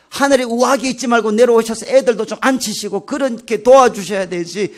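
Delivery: mock-furious pleading that builds in volume; angry complaint. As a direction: act out an indignant rant at God, starting restrained and rising to loud. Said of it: "하나님께 막 화를 막 냈어요".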